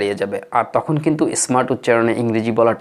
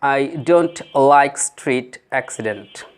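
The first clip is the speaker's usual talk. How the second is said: very slow, word by word; slow teacher demo; slow model reading; much slower than usual. Shown as a demonstration. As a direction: read it out slowly for learners. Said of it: "আজকের প্রথম বাক্যটি হলো".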